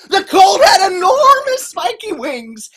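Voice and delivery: loud, high exclaiming narration; alarmed reveal. A narrator's reading. Telling kids of spiky wings, loud alarm. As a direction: burst out with the monster's horrors.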